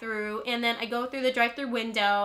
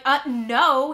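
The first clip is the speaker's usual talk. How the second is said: loud refusal; 9 dB louder than usual. Retold or imitated. Refusing to work off the bill.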